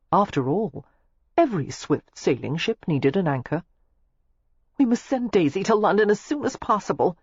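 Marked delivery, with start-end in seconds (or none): 4.8-7.2 fretting. A narrator's reading. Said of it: "Lillian fretted".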